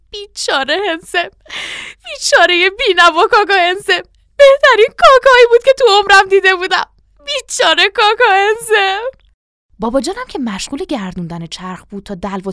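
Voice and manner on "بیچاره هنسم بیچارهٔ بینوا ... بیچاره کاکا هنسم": high, loud mournful wailing; keening lament. A narrator's reading. Dazed mother mourns Kaka at the well, wailing. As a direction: wail on and on, swelling louder. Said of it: "یک ریز زبون گرفته بود".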